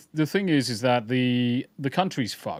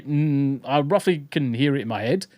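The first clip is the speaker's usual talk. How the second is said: normal talking voice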